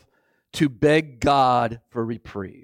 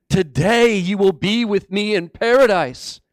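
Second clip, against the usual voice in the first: raised, ringing repeat of a promise; emphatic preaching; 5 semitones higher, 4 dB louder than usual. Acted. Preacher repeating Jesus' promise to the congregation, emphatic. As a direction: proclaim it to the congregation.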